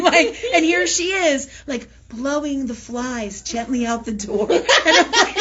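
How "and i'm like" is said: higher and louder, breaking into laughter; overwhelmed by giggles; laughing fit. Real, not acted.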